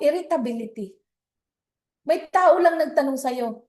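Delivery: normal talking voice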